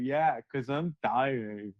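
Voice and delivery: slow, low, dreamy drawl; blissed-out and drawn-out, low; blissfully happy. Retold or imitated. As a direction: act out a dazed, ecstatic answer at half speed. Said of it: "Why are you so happy about it?".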